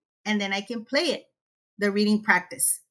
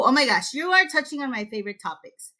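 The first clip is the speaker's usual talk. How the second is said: ordinary talk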